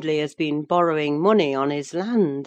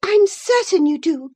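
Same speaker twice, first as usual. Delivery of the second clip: high, loud comeback; sharp retort; 13 semitones higher, 8 dB louder than usual. Acted. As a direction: retort hotly with total certainty. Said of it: "retorted Isabella".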